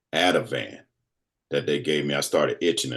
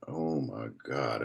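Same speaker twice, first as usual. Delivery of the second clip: stunned hush; 3 semitones lower, 10 dB quieter, much slower than usual. Retold or imitated. Stunned reaction to stroke news.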